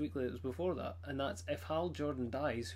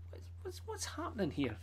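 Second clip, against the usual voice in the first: mock bewilderment; 8 semitones higher, 4 dB quieter than usual. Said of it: "I'm like".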